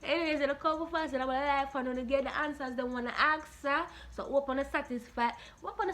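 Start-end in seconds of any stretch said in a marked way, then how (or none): none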